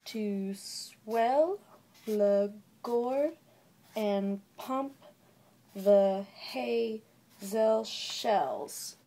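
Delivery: slow scansion, every stressed beat drawn out and punched; exaggerated iambic stress. Shown as a demonstration. Vlogger demonstrating verse meter, exaggerated iambic stress. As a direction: hit SWELL, GORE, PUMP and SHELLS hard, with pauses between feet. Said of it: "it will basically look like this".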